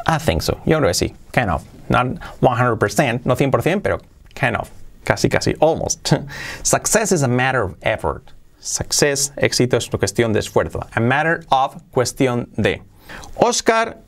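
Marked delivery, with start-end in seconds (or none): none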